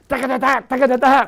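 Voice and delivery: high, loud scat of a drum roll; a quick vocal drum roll, high and loud; mouth drum fill. Retold or imitated. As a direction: rattle off the fill with your mouth. Imitating his last drum fill.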